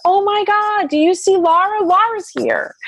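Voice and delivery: high, urgent, breathless excitement; excited disbelief. Retold or imitated. To her husband in the hospital, high excited disbelief. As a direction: sound like you can't believe your eyes. Telling husband she sees Laura.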